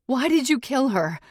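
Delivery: high, loud frightened demand; panicked accusation. Acted. Captive confronting a killer, panicked accusation. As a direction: demand an answer in alarm.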